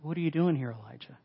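soft question, falling away on the name; quiet, tender voice of God; gentle whisper. Acted. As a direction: ask it gently, hushed. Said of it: "this gentle whisper".

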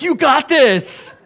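high, loud, stretched-out encouragement; mock cheerleading. Pastor friend not yet fasting cheers him on, mock encouraging.

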